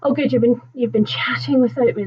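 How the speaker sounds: ordinary talk, nothing marked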